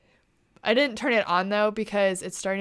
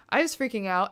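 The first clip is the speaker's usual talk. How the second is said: usual speaking voice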